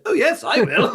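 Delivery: high, eager royal lady impression; Camilla. Camilla accepts the drink invitation, high imitated voice. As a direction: pitch it up, half laughing, as her. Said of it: "And she went".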